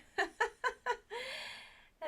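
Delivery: a burst of high laughter; bright laugh. Real, not acted. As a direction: laugh at yourself out loud. Laughing at her own boast.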